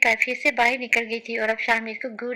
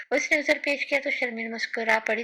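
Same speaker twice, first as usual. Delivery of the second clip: ordinary delivery, nothing marked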